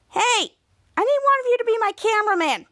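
high, fast imitated demand; acted pushy request; bossy demand. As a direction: order friends to help, all in a rush. Piper demands help from kitchen.

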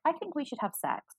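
high-pitched upfront proposition; acted-out forward come-on; assertive. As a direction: imitate a friend propositioning bluntly, pitched well up. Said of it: "couldn't be more assertive".

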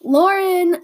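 loud call up high; calling out sharply for Lauren; mom's scream. A narrator's reading. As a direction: shout her name across the house. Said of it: "Mom screamed".

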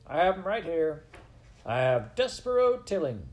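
loud, high-pitched proclamation; shouting. A narrator's reading. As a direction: announce your catch over the crowd. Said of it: "shouted Furlow".

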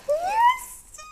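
excited. Real, not acted. Cheering over Easter eggs.

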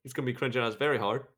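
rapid-fire delivery; talking fast. Acted. Attempting a British accent for a co-host, very fast. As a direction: rattle it off quickly. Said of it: "You talk so much faster".

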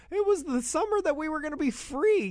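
high mocking impression of the bandmate. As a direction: voice jumps way up, dreamy and mocking. Retelling the plane confessions.

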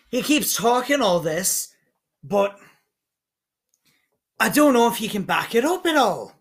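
high-pitched, put-on dwarf voice; indignant dwarf smith. Dwarf agrees with the mediator about his rival, indignant dwarf smith. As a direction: grumble about your rival as a proud dwarf.

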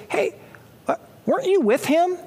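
high-pitched schoolgirl question, rising at the end; middle school girl. Retold or imitated. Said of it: "a middle school girl".

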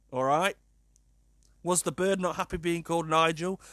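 normal talking voice

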